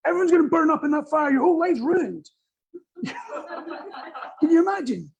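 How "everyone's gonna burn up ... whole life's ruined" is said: higher, louder mock alarm; hammed-up panic about the fire; panicking fireman. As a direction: overdramatic doom-saying fireman. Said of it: "If the fireman's like".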